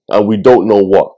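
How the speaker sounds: ordinary talk, nothing marked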